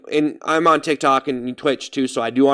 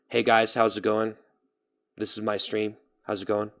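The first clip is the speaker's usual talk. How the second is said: softer, laid-back stream greeting; relaxed, not caring; 4 semitones lower, 6 dB quieter than usual. Shown as a demonstration. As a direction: say it like you don't really care. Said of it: "relaxed talking and not really caring".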